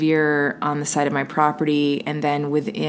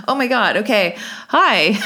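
high, louder mock-startled greeting; re-enacted surprise, pitched up; 6 semitones higher, 4 dB louder than usual. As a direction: act flustered by an unexpected visitor. Acts startled greeting to a spirit.

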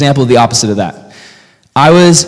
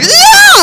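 very high, loud comic shriek; mock terrified scream; 6 dB louder than usual. Husband running on air from a spider, high mock shriek. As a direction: scream as if fleeing in panic.

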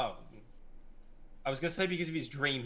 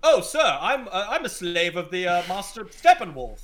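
hammed-up British accent, higher and louder; mock posh British minion voice, raised pitch; 6 semitones higher, 8 dB louder than usual. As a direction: play a polite British servant monster. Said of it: "in, like, a very British accent".

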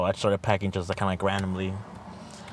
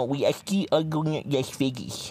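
higher, slow smug announcement; teasing friend voice; 6 semitones higher, slower than usual. Retold or imitated. Friend filming his face, teasing about Vegas in a higher, slow voice. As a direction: play the smug teaser announcing it.

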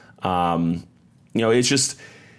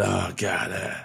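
mock sheepish groan; 6 dB quieter, slower than usual. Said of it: "shaking his head".

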